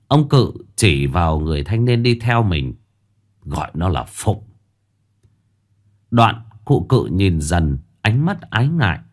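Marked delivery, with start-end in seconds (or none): 3.4-4.4 trầm và nhỏ, giọng ông già chậm rãi; hạ giọng xuống như cụ già điềm đạm; giọng ông lão. Acted. Ông cụ giới thiệu đệ tử. (cụ già giới thiệu tên với Dần, giọng trầm chậm)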